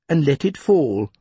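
ordinary delivery

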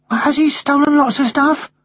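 high-pitched schoolboy question; young boy. Acted. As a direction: ask your mother in a high child's voice. Boy questions his mother.

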